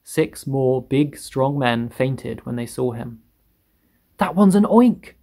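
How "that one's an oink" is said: high, loud cry; screamed alarm. A narrator's reading. Fire chief sees the grasshopper, screaming. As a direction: shriek the monster's name. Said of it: "screamed the head of the fire department".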